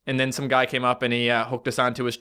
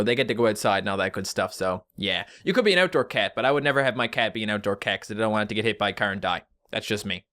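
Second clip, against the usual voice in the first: ordinary talk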